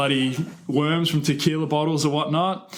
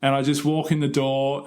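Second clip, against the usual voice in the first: usual speaking voice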